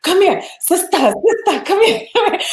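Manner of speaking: high, eager call of a market seller; vendor calling out. Retold or imitated. Vendor at the Maasai market calling to a shopper, high and eager. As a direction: beckon her in with a high sing-song sister. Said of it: "She was like".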